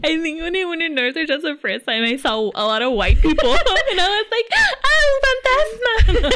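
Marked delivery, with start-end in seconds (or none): 4.9-6.3 drawn-out laughing "Oh, fantasma"; laughing mock gasp. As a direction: gasp it out slowly through laughter.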